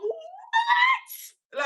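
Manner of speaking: high, shrieking "What?" held back from a full scream; stifled shocked scream. Retold or imitated. Hearing her friend's mum wants to join, a shocked squeal. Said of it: "only because it was late I didn't scream".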